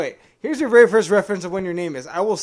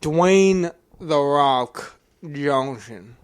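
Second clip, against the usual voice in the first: very slow Southern drawl; exaggerated, drawn-out Southern accent; put-on Southern accent; 4 semitones lower, 4 dB louder, much slower than usual. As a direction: stretch the name out in a mock Southern accent. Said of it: "What the fuck was that accent?".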